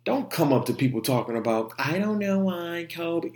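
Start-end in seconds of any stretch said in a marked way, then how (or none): 1.8-3.4 mocking voice. Mocking a dismissive coworker.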